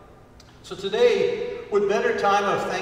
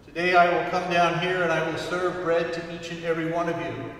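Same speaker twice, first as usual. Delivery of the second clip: ordinary delivery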